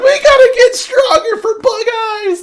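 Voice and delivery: mock-crying shout, extremely high; Inosuke. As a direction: loudmouth bawling through tears. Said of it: "there's all these tears coming out".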